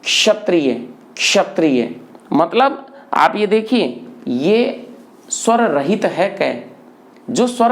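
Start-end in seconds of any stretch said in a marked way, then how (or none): 0.0-2.0 क्षत्रिय twice, each opening on k joined to sh; ksha demo. Shown as a demonstration. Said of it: "इसका उच्चारण आप करेंगे". (क्षत्रिय में क्ष का साफ़ उच्चारण)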